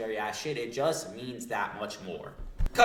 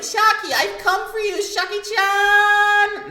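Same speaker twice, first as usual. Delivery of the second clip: very high, loud singsong call; hammed-up lovestruck cry, last word held long; smitten suitor call; 11 dB louder, much slower than usual. [name] calling out to his beloved Shocky, smitten suitor call.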